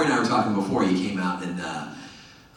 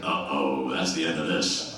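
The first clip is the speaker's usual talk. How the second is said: low, resigned aside; mock-ominous drop in pitch; lightly acted sinking realization; retold dread; 6 semitones lower than usual. His dread at the call.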